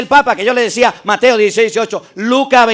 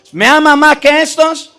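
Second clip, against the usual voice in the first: "¿me amas más que estos?" with más lifted high and pushed; stress on más; 6 semitones higher than usual. Shown as a demonstration. Preacher telling the crowd to listen, pressing on más. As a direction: lean hard on más.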